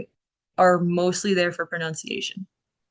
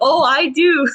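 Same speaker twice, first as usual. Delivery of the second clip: loud, high, through real laughter; laughing insistence; 8 semitones higher, 8 dB louder than usual. Real, not acted. Teacher teasing a reluctant student, laughing insistence. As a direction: insist gleefully while cracking up. Repeats it amid shared laughter.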